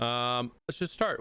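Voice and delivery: ordinary talk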